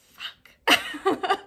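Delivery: high, loud curse as the tears break through; a real swear that cracks into tears; tearful outburst. Real, not acted. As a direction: let it burst out as you crack. Breaking down over losing her job.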